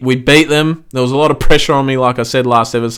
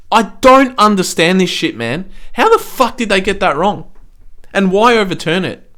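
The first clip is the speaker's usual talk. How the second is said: higher pitched, exasperated fury; angry rant; 7 semitones higher than usual. Real, not acted. Fan ranting at the video umpire, angry. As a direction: vent at the umpires.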